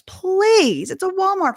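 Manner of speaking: high, loud mock protest; exasperated courtroom rebuttal, hammed-up; incredulous arguing. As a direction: scoff at the claim as the opposing side. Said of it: "the other side was arguing".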